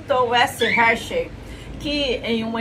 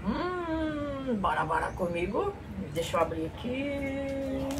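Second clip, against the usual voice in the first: soft voice; 3 semitones higher, 9 dB quieter than usual. Acted. Soft intimate unboxing.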